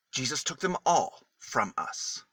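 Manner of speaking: plain reading voice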